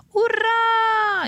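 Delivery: very high, loud joyful cheer; cheering shout. A narrator's reading. Children cheering the lion's return, loud high hooray. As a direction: shout hooray like happy kids. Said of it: "esclamarono i bambini".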